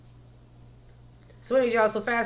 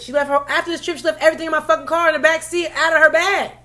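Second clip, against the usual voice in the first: higher, louder, fast irritated rant; fast loud complaint about the mess; angry rant; 8 semitones higher, 10 dB louder, faster than usual. Real, not acted. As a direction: vent how mad she made you. Ranting about friend's mess.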